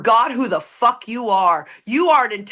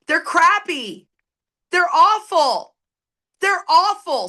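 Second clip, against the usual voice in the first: exasperated; 6 semitones higher, slower than usual. Real, not acted. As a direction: hammer how bad it is. Lament on recovery rates.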